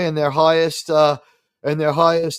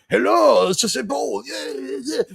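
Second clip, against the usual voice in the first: high-pitched, hammed-up actor impression; mock blurted gibberish; 8 semitones higher than usual. Host mocking the actor's Spanglish garbage, high blurted impression. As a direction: blurt it out in a silly put-on accent. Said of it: "blurting out".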